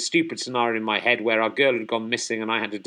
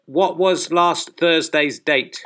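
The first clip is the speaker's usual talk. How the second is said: loud, slow, spelled-out questioning; slow over-clear command; 4 semitones higher, 3 dB louder, much slower than usual. Acted. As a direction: speak slowly and clearly to a machine. Asking the phone again.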